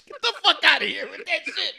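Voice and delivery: high laughing exclamation; laughing outburst. Real, not acted. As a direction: laugh it out in disbelief.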